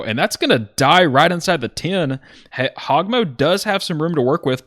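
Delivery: thrilled commentator; excited call. Real, not acted. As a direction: sell the big punt like a highlight. Great punt called live.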